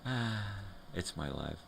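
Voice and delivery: ordinary talk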